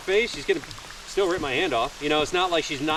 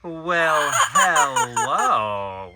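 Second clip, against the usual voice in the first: sultry voice; 2 semitones higher, 7 dB louder, much slower than usual. Retold or imitated. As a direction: purr it like a flirt. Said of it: "in a very, like, sultry".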